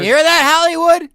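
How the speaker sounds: loud, high call to Hollywood. Acted. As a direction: shout it out to the town. Calling out to Hollywood.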